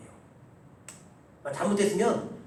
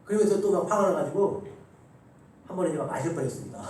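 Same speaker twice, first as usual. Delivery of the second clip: usual speaking voice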